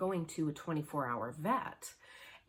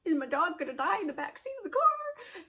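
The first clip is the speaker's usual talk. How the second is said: much higher, louder, near tears; freaking out; 13 semitones higher, 7 dB louder than usual. Retold or imitated. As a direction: relive the panic, voice cracking toward a cry. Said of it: "freaking out".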